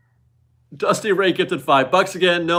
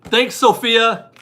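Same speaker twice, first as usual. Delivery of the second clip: mock indignant thanks; 6 semitones higher, 5 dB louder, much slower than usual.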